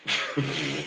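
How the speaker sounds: low, soft chuckle; amused laugh. Real, not acted. Laughs at the opponent.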